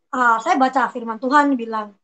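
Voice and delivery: ordinary talk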